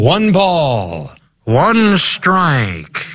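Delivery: put-on high, bright old-timey play-by-play; old radio announcer. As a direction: call it like a 1930s radio man. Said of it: "I'm gonna do an old-timey radio voice".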